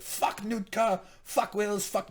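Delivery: high, play-acted cursing; hammed-up frustration, pitched well up; mock exasperated outburst. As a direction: imitate a fed-up man's rant. Voices character's exasperated outburst.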